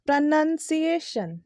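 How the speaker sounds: "pronunciation" slowly as pruh-nun-see-ay-shun; correct pronunciation. Shown as a demonstration. Teacher corrects the error, "pronunciation" as pruh-nun-see-ay-shun. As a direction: draw out "pronunciation", keeping "nun" short.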